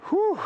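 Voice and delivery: breathless high whew after the climb; huffing and puffing. Real, not acted. Host catches his breath on the mountain, huffing. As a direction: blow out the air, winded from the steep trail. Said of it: "Here comes the huffing and puffing part".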